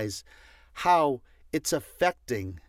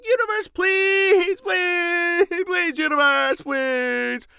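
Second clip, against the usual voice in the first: high, whiny, drawn-out pleading; hammed-up plea, much higher; mock begging; 7 dB louder, slower than usual. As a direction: beg the universe like a needy child. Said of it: "then you beg".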